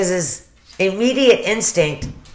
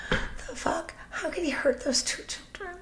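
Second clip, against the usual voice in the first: stunned disbelief; 13 semitones higher, 12 dB quieter than usual. Real, not acted. Outraged disbelief at the killer.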